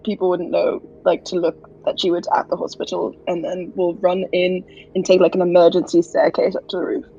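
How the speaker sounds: usual speaking voice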